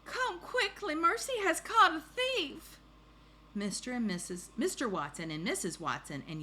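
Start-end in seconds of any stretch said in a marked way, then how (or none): 0.1-2.8 very high excited shout; high storybook yell, building louder. Acted. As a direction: raise the alarm like a small child. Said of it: "shouted Baby".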